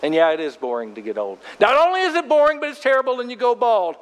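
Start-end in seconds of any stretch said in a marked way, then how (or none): 1.6-4.0 mock whiny complaint. Mock complaint about getting old.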